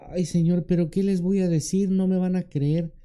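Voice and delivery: high, worried protest; reluctant servant pleading his way out; Moses making excuses. Acted. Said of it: "aquel que pone pretextos como Moisés".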